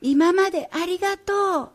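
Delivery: high-pitched, projected call. A narrator's reading. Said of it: "大きい声で言ったら".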